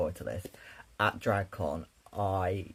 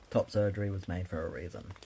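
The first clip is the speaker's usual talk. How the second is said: ordinary talk, nothing marked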